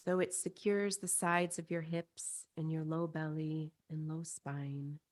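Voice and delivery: soothing guided-visualization voice, spacious phrasing; guided meditation. Acted. Leads a chakra meditation.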